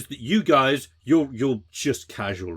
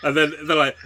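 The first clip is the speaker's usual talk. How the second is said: ordinary talk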